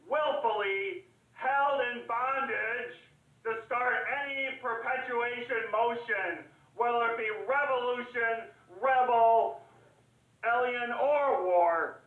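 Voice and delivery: high-pitched, emphatic declamation; rousing oratory. Acted. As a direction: build the list like a rallying speech. Orator builds a list of struggles.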